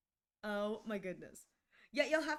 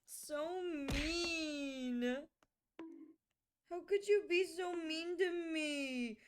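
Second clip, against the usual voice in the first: higher, slow mock whimper; mock hurt; 5 semitones higher, much slower than usual. Streamer whines at pelting viewers in mock hurt. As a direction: play wounded, whining for pity.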